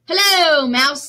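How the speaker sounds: storybook duck hailing the dog; cheery duck greeting. Acted. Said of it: "said Duck".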